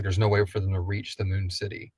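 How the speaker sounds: normal talking voice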